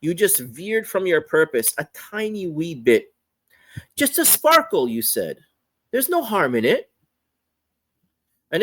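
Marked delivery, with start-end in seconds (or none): 4.0-4.9 much higher, lightly voiced excuse; playful excuse. The heart excusing its small distraction, playful excuse. 5.9-6.9 high, quick, dismissive reassurance; playful excuse. Acted. The heart waving away any harm, playful excuse.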